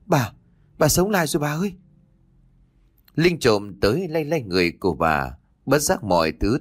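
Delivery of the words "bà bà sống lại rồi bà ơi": high, startled call to grandma; narrated cry of disbelief, voice lifted high. A narrator's reading. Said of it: "Linh liền kêu lên".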